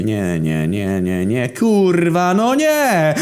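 very high, loud, slow despairing wail; anguished cry. Acted. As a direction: wail as your worst fear comes true. Narrator despairs hearing the song.

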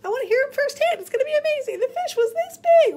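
a wide-eyed follower hanging on every word; high, eager fanboy asking Jesus for his fish stories; eager disciple. Pretend. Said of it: "old school disciple".

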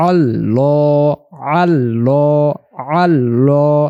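আল্লাহ with a heavy, rounded lam; slow, full-mouthed আল্লাহ. Shown as a demonstration. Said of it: "একটু ভারী আওয়াজে পড়তে হবে".

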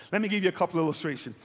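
ordinary talk

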